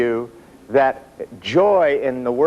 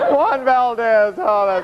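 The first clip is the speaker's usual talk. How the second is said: mock weepy; 12 semitones higher, much slower than usual. Mock crying at coffee ad.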